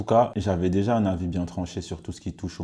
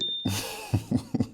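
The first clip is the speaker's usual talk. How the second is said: embarrassed little laugh; nervous laugh; 2 semitones higher than usual. Real, not acted. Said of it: "je rigole parce que je suis gêné". (rire gêné)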